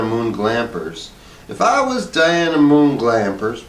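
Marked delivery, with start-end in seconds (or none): 1.5-3.6 high, slow daydreaming wife; Hazel's voice. A narrator's reading. Wife imagining herself in charge, high and slow. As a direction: dream aloud, high and slow. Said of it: "said Hazel".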